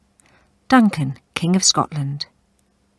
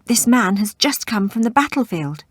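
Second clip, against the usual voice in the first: panting; 6 semitones higher, 4 dB louder than usual. Acted. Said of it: "Prince Malcolm panted".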